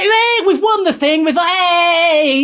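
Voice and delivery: put-on high, excited Italian accent; mock Italian accent, pitched well up. Presenter imitating a celebrating football boss, mock Italian accent. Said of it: "I'm doing an Italian accent".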